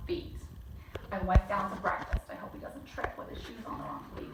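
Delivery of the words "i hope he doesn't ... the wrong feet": soft unvoiced whisper; whispered aside. Real, not acted. Whispering a worried aside to the listener. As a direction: lean in and whisper it.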